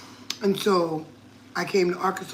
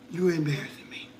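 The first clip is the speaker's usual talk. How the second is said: whispered scolding; 4 semitones lower, 7 dB quieter than usual. Retold or imitated. Scolding granddaughter about TikTok video.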